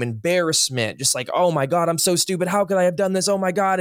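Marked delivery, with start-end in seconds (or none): 1.3-3.8 panicked self-blame. Retold or imitated. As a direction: pitch climbing higher with each line, frantic. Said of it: "And I was shaking in shame".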